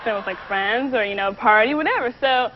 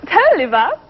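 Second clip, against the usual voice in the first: put-on Valley girl accent; 5 semitones higher, 5 dB louder than usual. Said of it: "Say something, Valley girl".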